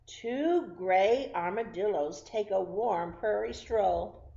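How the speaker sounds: loud, slow storybook read; lively picture-book reading; reading to children. Acted. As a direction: read each word big for small kids. Reading counting book to kids.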